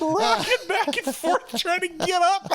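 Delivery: high, slow, laughing through the story. Real, not acted. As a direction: tell it while giggling. Laughing while describing video.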